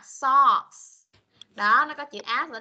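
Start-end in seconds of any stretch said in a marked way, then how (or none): 0.0-1.0 "socks" with the S hissed out; loud, clear S ending on "socks"; teacher modelling the S in "socks"; final S let out. Shown as a demonstration. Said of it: "Sờ ra ha".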